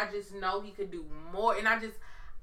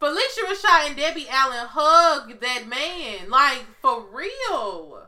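loud, laughing call to hug him; laughing plea; 4 semitones higher, 8 dB louder, slower than usual. Pretend. Pleading with celebrity women, laughing loud call.

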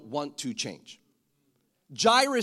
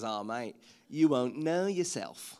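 drawn-out Australian slang delivery; Aussie accent; 5 semitones lower, 4 dB quieter, slower than usual. Retold or imitated. Preacher's Aussie wife talks to a girl, Australian drawl. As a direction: stretch the vowels like an Aussie wife. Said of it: "My wife is Aussie".

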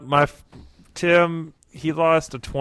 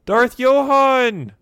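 high, slow dramatic announcement; dramatic high reveal; 10 semitones higher, 5 dB louder, much slower than usual. Acted. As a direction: proclaim the name grandly.

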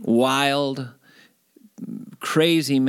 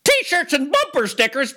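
panicked blurt; 14 semitones higher, 3 dB louder than usual. Retold or imitated. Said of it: "and I just went".

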